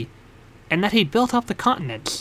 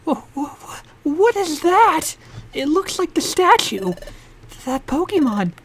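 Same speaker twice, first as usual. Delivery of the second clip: put-on high young hero voice; 8 semitones higher than usual. Hero character voice, startled.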